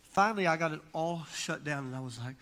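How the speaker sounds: ordinary delivery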